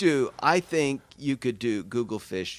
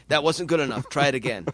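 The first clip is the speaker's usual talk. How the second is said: demanding acting coach, louder; a hint of a pushy drama coach; drama teacher; 3 semitones higher, 6 dB louder than usual. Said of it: "you sound like an acting coach".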